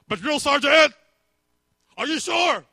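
loud, very high hammed-up cry; shouted question. Soldiers doubting their drill sergeant, high shouted question. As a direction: yelp it in comic disbelief.

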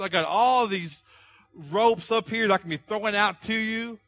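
preaching at full force. Acted. Pastor urging the congregation, preaching at full force. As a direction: preach it hard to the room.

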